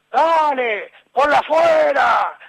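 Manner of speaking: high, drawn-out yell from afar; imitated coach's shout. Coach yelling at his young player from afar, imitated shout. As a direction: holler it like a coach across the pitch. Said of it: "me gritaba don Vittorio allá".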